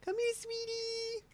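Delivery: mock indignant pet voice; voicing the cat. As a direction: speak for the cat, sassy and offended. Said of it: "she's like".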